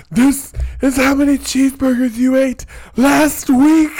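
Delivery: hammed-up TV host busting him; mock confrontation. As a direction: show host dumping cheeseburgers on him, a mock loud gotcha. Show hosts confront the cheeseburger eater.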